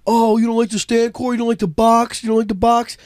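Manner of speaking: mock-challenging heckle, lightly teasing; playful taunt. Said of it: "Kinda playful".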